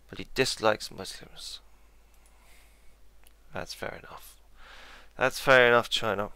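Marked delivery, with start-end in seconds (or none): 3.5-4.4 whispered under his breath; a voiceless aside to himself; muttered. Real, not acted. Player whispering his verdict on China. 5.2-6.3 louder, spoken out to the map; called out. Real, not acted. Player calling across to China, louder. Repeats aside loudly at China.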